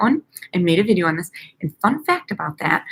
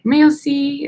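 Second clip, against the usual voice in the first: imitating French; 7 semitones higher, 5 dB louder, slower than usual. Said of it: "I know I'm saying that wrong".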